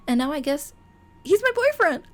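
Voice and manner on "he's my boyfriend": excited squeal. Real, not acted. Squeals at new boyfriend line.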